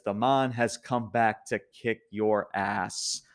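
slow, deliberate quoted threat; retelling a challenge, slowly and deliberately; mock threat. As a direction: space out each word like a promo line. Quotes wrestler's threat, slowed down.

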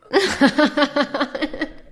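risa estruendosa y aguda; carcajada fuerte. Real, not acted. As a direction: explota de risa de verdad. Carcajada tras "no sé, mamá. (carcajada sonora)

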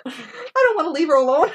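high, laughing mock sob; crying Billy. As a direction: whimper it like a scared kid, half laughing. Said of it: "I'm gonna die".

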